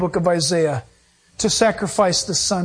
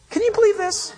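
pitched up, can you believe this; incredulous; 12 semitones higher than usual. Real, not acted. Pastor to congregation, incredulous. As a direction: throw up your hands at the claim.

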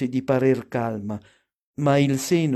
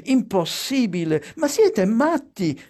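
high protest, climbing to an exasperated peak; embarrassed mother protesting in a higher pitch; flustered fending-off; 6 semitones higher than usual. A narrator's reading. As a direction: shoo the children off, voice rising. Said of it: "cercava di schernirsi, ripetendo".

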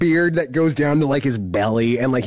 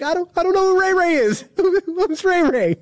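very high, squeaky comic plea; hammed-up bewildered wail with a laugh; mock panicked protest. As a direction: plead innocence in an exaggerated panic. Newcomer protesting a false murder accusation.

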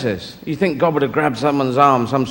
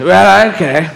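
James Mason; 3 semitones higher, 4 dB louder, slower than usual. Acted. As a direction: back into the grand actor voice. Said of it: "All right, do the James Mason".

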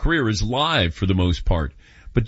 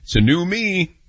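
mock bravado; 6 semitones higher than usual. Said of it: "I'm saying".

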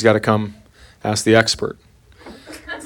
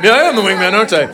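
lightly acted, pleased father-in-law; a touch of proud delight, pitched up; happy father-in-law; 4 semitones higher than usual. Father-in-law delighted by his wingman nickname, happy. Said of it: "He just gets all happy".

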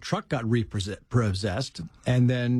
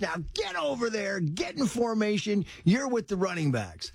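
mock coach's shout; 8 semitones higher than usual. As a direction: imitate a coach calling orders from afar. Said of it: "he was gonna be screaming at me".

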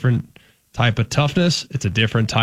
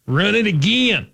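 higher, slow mock coach bark; O-line coach impression; 9 semitones higher than usual. Host answering co-host's bit, slow mock coach bark. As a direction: drag out a line coach's demand. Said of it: "impersonate offensive line coaches".

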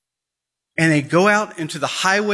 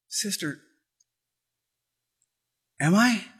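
hushed doubt; 4 semitones higher, 5 dB quieter than usual. Acted. Girl asks, hushed and unsure.

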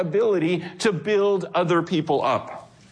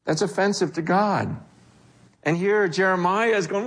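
ordinary talk

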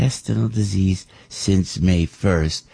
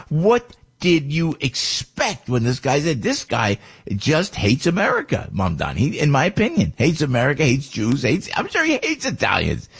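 higher, slowed, head-shaking disbelief; exasperated retelling; 7 semitones higher than usual. Retold or imitated. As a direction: spell it out to an imagined listener in dismay. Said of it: "I'm shaking my head going".

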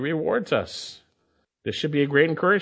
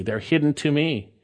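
ordinary talk

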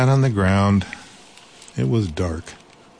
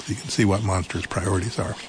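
ordinary delivery, nothing marked

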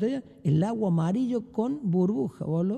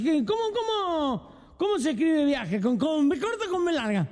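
put-on drunk voice; 8 semitones higher than usual. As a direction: drag it out like a drunk. Drunk asks spelling question.

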